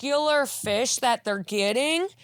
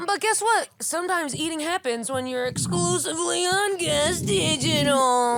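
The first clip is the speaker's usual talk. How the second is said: high, bright ad read; announcer voice; 6 semitones higher than usual. Acted. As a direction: pitch it like a promo. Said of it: "ad read plug".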